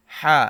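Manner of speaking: হা squeezed from mid-throat; pressed h. Shown as a demonstration. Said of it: "একটু চাপ দিতে হবে".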